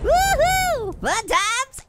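high, loud woohoo; excited whoop. Real, not acted. Player cheers the frog's flight, excited whoop. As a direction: whoop with glee.

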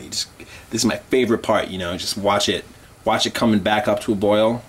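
usual speaking voice

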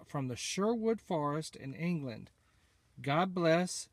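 ordinary talk

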